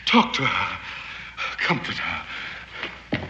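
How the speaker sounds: slow, breathy whisper; soft, drawn-out whisper. Acted. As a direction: hush it as you leave. Parting instruction, fading away.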